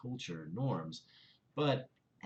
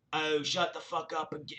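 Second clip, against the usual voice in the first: put-on South London accent; 7 semitones higher, 5 dB louder than usual. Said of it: "do my best South London accent".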